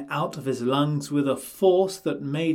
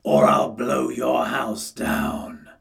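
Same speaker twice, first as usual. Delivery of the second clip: wolf voice; 2 semitones lower, 5 dB louder, slower than usual. A narrator's reading. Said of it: "cried the wolf".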